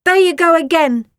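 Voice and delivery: exasperated snarl; growled. A narrator's reading. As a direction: Nellie losing patience. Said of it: "growled Nellie".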